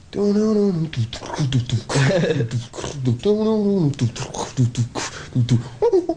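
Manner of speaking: beatbox drum and bass with a laugh. Retold or imitated. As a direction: mimic a drum and bass beat with your mouth. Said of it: "like that".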